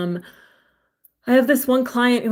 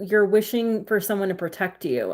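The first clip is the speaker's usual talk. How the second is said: ordinary talk, nothing marked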